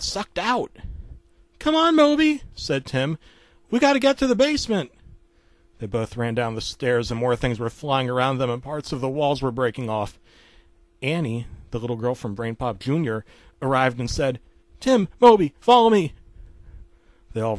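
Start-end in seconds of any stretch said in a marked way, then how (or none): 1.6-2.4 much higher, loud urgent call. Acted. Tim hurrying Moby away from the window, urgent high call. 3.7-4.9 fast, high, loud urgent call. Acted. Tim telling Moby to get to the basement, fast panicked call. 14.8-16.1 put-on high, loud little girl's call. Annie, the little girl, calling Tim and Moby, high loud call.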